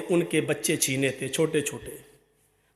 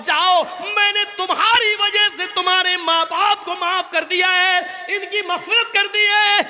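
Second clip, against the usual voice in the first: raised, fervent sermon storytelling voice; impassioned preaching; 12 semitones higher than usual. Acted. Preacher telling the congregation of children in paradise, fervent preaching. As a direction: preach it with rising fervour to the crowd.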